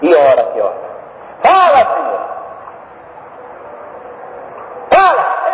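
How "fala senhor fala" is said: shouted prayer demanding an answer; crying out. Acted. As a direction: call out to God, insisting. Men crying out to God.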